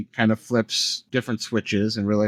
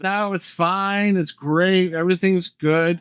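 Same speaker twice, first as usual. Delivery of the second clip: mock-reassuring, higher and drawn out at first; breezy dismissal; 6 semitones higher than usual. Host mimicking the movie's denier, mock-reassuring. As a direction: imitate the one who waves every worry away. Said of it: "And the other one's".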